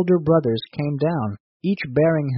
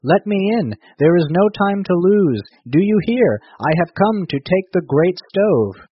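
loud, higher-pitched urgent demand; raised, insistent storybook call; 5 semitones higher, 5 dB louder than usual. A narrator's reading. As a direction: call through a door to be let in. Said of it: "A strange voice called out".

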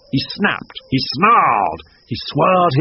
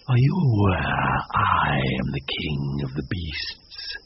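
much lower, slow silky threat; king of beasts looming over prey; low, slow, purring lion; menacing lion; 9 semitones lower, 4 dB quieter than usual. Acted.

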